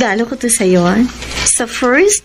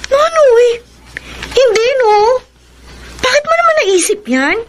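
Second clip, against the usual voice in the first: high, indignant "how could you"; shocked protest; 9 semitones higher than usual. Acted. Xiomara protesting Nonoy's accusation, shocked and high. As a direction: bristle at the insult, pitch jumping up.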